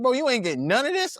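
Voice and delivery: high, needling mock question; teasing disbelief. Classmates taunting him over missing brands, in teasing disbelief. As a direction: imitate an incredulous classmate, pitched well up.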